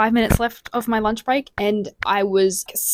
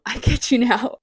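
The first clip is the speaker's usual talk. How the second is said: louder, stressing you with a laugh; chuckled, louder "I get you now"; laughing emphatic repeat; 4 semitones higher, 6 dB louder than usual. Shown as a demonstration.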